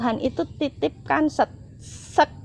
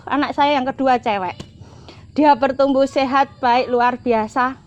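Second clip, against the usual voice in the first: usual speaking voice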